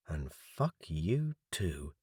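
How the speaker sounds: low, slow, under the breath; muttered bitter aside. A narrator's reading. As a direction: swear it quietly, slowly. Inner curse, bitter aside.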